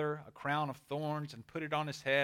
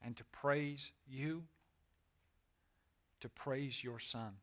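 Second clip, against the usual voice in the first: reverent prayer; 4 semitones lower, 7 dB quieter than usual. Real, not acted. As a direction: pray quietly, phrase by phrase. Leads a closing prayer.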